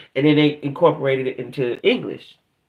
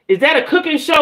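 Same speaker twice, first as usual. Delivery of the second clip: surprised question; 12 semitones higher, 4 dB louder than usual. Real, not acted. Sudden question about show name.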